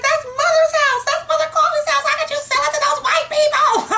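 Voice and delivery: reenacted scream over the sold house; retold screaming. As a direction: wail it in outrage. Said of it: "I was crying and screaming on the phone".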